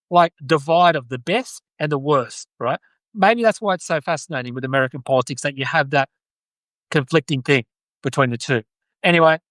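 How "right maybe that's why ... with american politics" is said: ordinary talk, nothing marked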